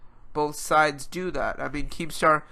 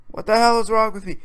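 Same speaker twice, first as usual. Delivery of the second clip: louder, higher self-reproach; raised voice, exasperated at oneself; exasperated outburst; 5 semitones higher, 5 dB louder than usual. Real, not acted. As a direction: scold yourself in exasperation. Self-reproach, escalating repeat.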